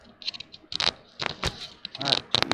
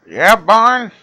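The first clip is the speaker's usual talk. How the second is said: Fred Flintstone voice; 8 dB louder than usual. Retold or imitated. Fred voice cartoon.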